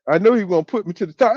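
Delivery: usual speaking voice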